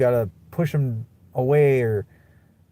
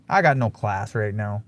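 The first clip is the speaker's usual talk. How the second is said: usual speaking voice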